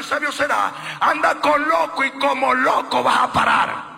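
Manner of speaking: loud, slow, high-pitched preaching cry; sermon climax shouted over the music; full-force preaching. Acted. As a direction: hammer each name out to the congregation.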